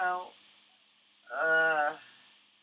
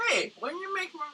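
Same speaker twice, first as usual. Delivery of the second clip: higher comic complaint; Ben Stiller-type whine. Retold or imitated. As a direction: whine like a rom-com lead. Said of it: "He's probably like".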